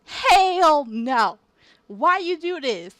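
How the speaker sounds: loud rattled complaint; indignant protest. Real, not acted. Player protesting to the game after a scare, indignant and loud.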